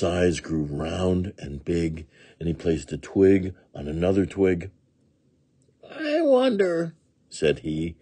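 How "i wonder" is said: very high, drawn-out musing; thoughtful woodchuck. A narrator's reading. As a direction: wonder slowly in a high critter voice. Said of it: "with a thoughtful cluck".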